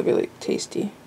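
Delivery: soft breathy whisper. Real, not acted. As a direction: breathe it out softly. Savoring coffee, breathy aside.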